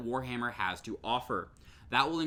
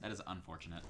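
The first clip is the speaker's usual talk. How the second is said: low, much softer deadpan; 9 semitones lower, 13 dB quieter, faster than usual. Real, not acted. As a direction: say it flat and dry. Deadpan reply to the delayed cheer.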